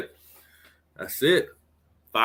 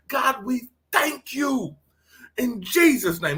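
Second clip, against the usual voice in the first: raised, fervent prayer voice; 4 semitones higher than usual. Acted. Pastor praying over his online family, fervent prayer. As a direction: pray it out with conviction.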